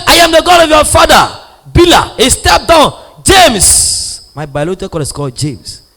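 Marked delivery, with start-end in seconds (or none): 0.1-4.2 high, loud declaration; loud proclamation. Retold or imitated. Preacher booming the governor's words to the crowd. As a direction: call it out to the crowd.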